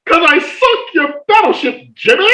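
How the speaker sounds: high blaring mock-ad yell; commercial shout. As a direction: belt it like an old TV commercial. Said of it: "I do this".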